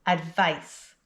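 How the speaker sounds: Advice with a crisp S ending; final S sound. Shown as a demonstration. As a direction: model "Advice" for repeating, S at the end. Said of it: "with the S sound at the end".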